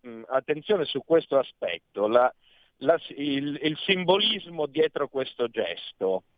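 ordinary delivery

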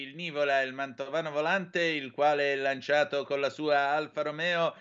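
put-on booming old newsreel voice; pompous wartime propaganda narrator; fascist newsreel announcer. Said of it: "mi scatta il cinegiornale".

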